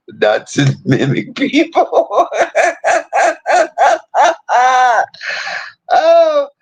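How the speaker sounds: high, helpless laughter breaking the words; laughing fit. Real, not acted. Cracking up at her own story about her cousin.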